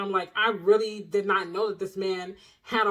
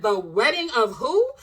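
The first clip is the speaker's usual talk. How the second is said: stunned, slow, loud disbelieving question; can't believe what she's reading; shocked disbelief; 2 semitones higher, 5 dB louder, slower than usual. Retold or imitated. Shocked at wedding album title.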